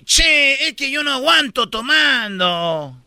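sing-song Argentine drawn vowels; Argentine accent. Acted. Argentine admits he can't hold drink, Argentine accent. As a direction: lilt it like an Argentine. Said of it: "en Argentina dice".

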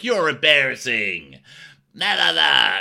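put-on high, loud childish taunt; taunting sing-song. Host mimicking Tom taunting Ashley, loud sing-song. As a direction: tease like a playground bully.

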